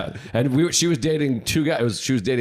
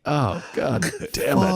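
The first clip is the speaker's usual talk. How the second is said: mock dismay; 4 semitones higher, slower than usual. Dismayed inner reaction.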